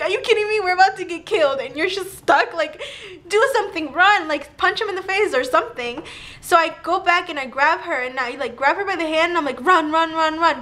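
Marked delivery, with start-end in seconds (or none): 0.0-6.0 high, laughing incredulous rant; half-laughing, can't believe she's just standing there; exasperated disbelief. Retold or imitated. Scolding her frozen friend while fleeing, high exasperated rant. 9.6-10.6 urgent run. Retold or imitated. Dragging her friend by the hand, urgent repeated run.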